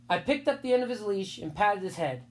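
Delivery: ordinary delivery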